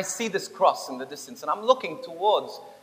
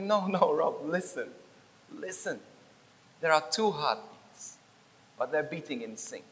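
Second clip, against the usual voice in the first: quiet, spaced words fading softer; gentle correction; 6 dB quieter than usual. Retold or imitated. Jesus tells Rob to listen for two heartbeats, gentle correction. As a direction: softly draw the listener's attention.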